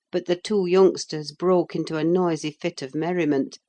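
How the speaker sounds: plain reading voice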